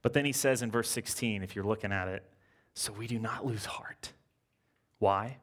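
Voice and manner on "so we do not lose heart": hushed whisper of scripture. Acted. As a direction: drop to a breathy whisper for weight. Reading scripture softly for emphasis.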